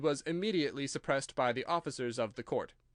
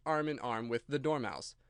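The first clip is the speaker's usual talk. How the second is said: plain reading voice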